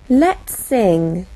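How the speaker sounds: ordinary talk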